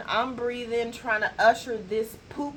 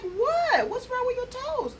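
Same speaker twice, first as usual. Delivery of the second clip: high, loud astonished question; retold surprise; 3 dB louder than usual. Therapist asking her client about her toes, high surprised. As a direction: throw the question up high in disbelief.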